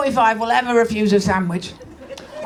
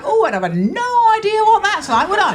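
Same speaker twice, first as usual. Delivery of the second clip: sarcastic; 9 semitones higher, 3 dB louder than usual. Retold or imitated. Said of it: "I'm like".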